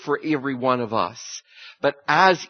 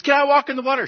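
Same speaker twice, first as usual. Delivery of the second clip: much higher, louder eager question; eager Peter; 13 semitones higher, 8 dB louder, much faster than usual. Acted. Peter asks Jesus to walk on water, eager and excited. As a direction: beg like an excited kid.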